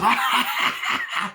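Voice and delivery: high, loud "ah" breaking into cackle; mock outburst. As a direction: imitate a manic cry, high and loud. Glenda turns around, retold outburst.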